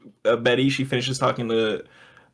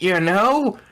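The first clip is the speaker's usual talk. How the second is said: surprised Betty; 8 semitones higher, 3 dB louder than usual. Retold or imitated. Betty surprised in retold scene.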